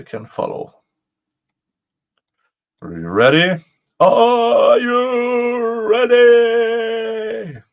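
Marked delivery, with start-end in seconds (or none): 4.0-7.6 higher, louder, very slow stretched call; drawn-out hype call. Shown as a demonstration. Host hyping viewers before reading the motion, in a stretched announcer call. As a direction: hype the crowd like a ring announcer. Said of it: "repeated as a drawn-out call".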